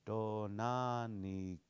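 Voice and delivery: slow, careful syllable-by-syllable "Donanikcha"; repeated slow "Donanikcha" practice; slow drill. Shown as a demonstration. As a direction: drill "Donanikcha" slowly for learners. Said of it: "you're gonna have to really work on".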